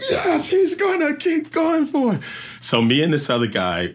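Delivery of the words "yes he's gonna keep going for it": much higher, slow crowd cheer. Retold or imitated. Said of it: "Everybody went".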